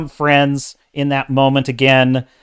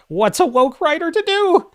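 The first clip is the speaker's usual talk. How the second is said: high, mocking sing-song; mock the writers sarcastically; sarcastic mock question; 14 semitones higher than usual. Real, not acted. Reviewer mocking woke writers, high sarcastic tone.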